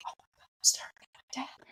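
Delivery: hushed, awed whisper; stunned whisper. Retold or imitated. Seeing her father at the door, whispering in shock. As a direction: breathe it out in shock.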